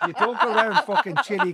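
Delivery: laughing in disbelief. Real, not acted. Host teases friend about his Spanish in Chile, laughing. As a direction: talk through a big laugh.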